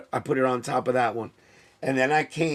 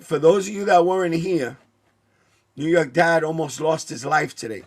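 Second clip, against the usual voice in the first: normal talking voice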